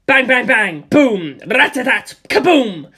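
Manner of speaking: loud punchy bangs and booms; loud mock gunfire; voiced gunfire. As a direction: blast the shots out.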